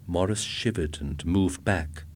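usual speaking voice

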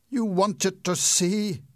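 loud, high, slow furious demand; angry cry. Acted. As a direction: roar it at her in rage. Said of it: "he cried".

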